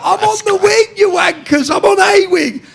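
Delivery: high, loud taunting shout; retold shouting. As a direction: re-enact hollering back from the cell window. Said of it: "I'm shouting what cell number".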